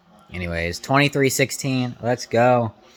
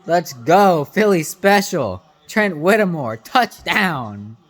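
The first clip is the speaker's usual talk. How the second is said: excited cheering; 8 semitones higher, 6 dB louder, much slower than usual. Real, not acted. Fan cheering another touchdown.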